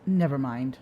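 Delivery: low, softened mock encouragement; sarcastic. Real, not acted. Host gives up correcting a debater's made-up word, quietly sarcastic. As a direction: drop the voice, dryly humour him.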